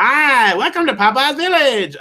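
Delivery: high, loud sing-out Olive Oyl greeting; Shelley Duvall. Retold or imitated. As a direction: squeal it like a cartoon Olive Oyl with a Maltese accent. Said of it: "doing a Shelley Duvall's Olive Oyl talk".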